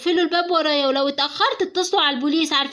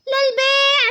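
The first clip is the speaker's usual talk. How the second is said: street seller call; 8 semitones higher, 3 dB louder than usual. Acted. Girl selling clay outside at night, chanting her call. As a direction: call out wares like a street vendor. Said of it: "عماله تقول صلصال للبيع".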